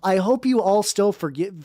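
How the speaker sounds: ordinary delivery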